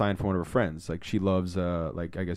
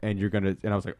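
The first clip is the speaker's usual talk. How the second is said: ordinary delivery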